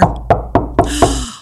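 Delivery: sharp storybook gasp of alarm; acted, high gasp; startled gasp. Knock at the door in story.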